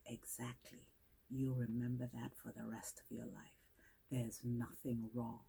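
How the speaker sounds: low, hushed mentor's voice; quiet affirmation. Retold or imitated. Mentor affirming a girl's way of speaking, hushed and low. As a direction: drop almost to a whisper, imitating a mentor's assurance.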